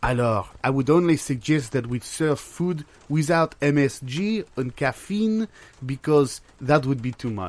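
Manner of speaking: put-on French accent, fussy accountant; mock French accent. Accountant fretting to his partner about the menu, in a mock French accent. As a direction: play a worried French bean counter.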